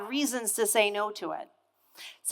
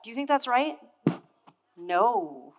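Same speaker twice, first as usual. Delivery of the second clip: normal talking voice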